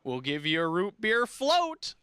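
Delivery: put-on high, slow old salesman call; old ad pitchman. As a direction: ham up the folksy RV pitch. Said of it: "this old guy who comes on and he goes".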